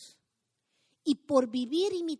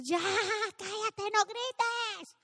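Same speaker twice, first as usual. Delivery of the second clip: madre regañando; 9 semitones higher, much slower than usual. Retold or imitated. Imita a madre gritando a hijos.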